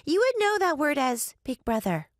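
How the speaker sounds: put-on high little-girl voice; child ghost explaining. A narrator's reading. Child ghost explaining a Korean word to guests, childlike.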